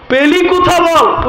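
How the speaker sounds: high, slow, drawn-out demand; mock interrogation. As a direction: press hard and slow for the source. Demanding where prayer came from.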